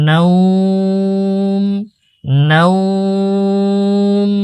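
নাওম drawn out slowly and closed on a held m; stopping on the m. Shown as a demonstration. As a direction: recite নাওম slowly, ending on the m. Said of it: "এভাবে ওয়াকফ করে দেবেন". (নাওম ধীরে টেনে ম-এ থামা)

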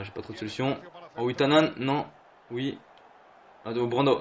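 ordinary delivery

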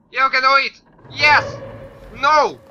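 loud, high cheer that flips to dismay; excited cheering. Real, not acted. Gamer cheering himself on at a jump, excited. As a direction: cheer yourself on, then cry out.

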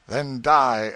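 loud villain's outburst; murderous threat. Acted. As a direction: strike out with the words, full force. Villain answers refusal with sword.

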